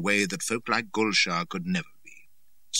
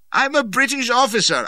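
high, loud protest; desperate cry; 9 dB louder than usual. A narrator's reading. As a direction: cry out who you are. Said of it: "I cried".

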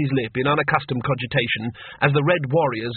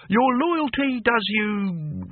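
slow, grand imperial voice; mock regal; 9 semitones higher, much slower than usual. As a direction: play the pompous emperor. Cohen mock-regal praise.

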